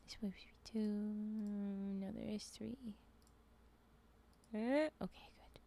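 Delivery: soft whispered counting. Real, not acted. Streamer working out the mines in a whisper. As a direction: whisper the numbers to yourself.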